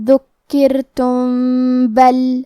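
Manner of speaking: ذکرتم بل with final meem hidden and nasalised into ba; hidden meem with ghunna. Shown as a demonstration. Teacher demonstrates ikhfa shafawi to students, slow nasal recitation. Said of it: "میم کو چھپاتے ہوئے اور غنہ کے ساتھ".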